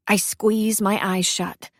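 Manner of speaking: ordinary delivery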